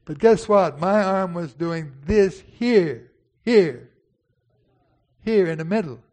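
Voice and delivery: loud, slow dramatic preaching. Acted. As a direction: build it up for the church. Preaching at full force.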